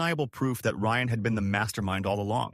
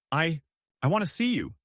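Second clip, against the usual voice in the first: mildly pleading, higher voice; desperate plea; 6 semitones higher than usual. A narrator's reading. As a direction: plead with a hesitant restart, pitch lifted. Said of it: "she pleaded, desperate".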